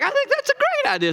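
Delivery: very high, quick fake cheer; sarcastic high-pitched agreement; mock enthusiasm. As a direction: squeak it out in hammed-up approval. Mock approving wife's plan.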